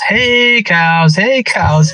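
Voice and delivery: mock a farmer calling his cows; farmer's yell, held back in volume; imitated cattle call. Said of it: "I can hear him just screaming at these cattle".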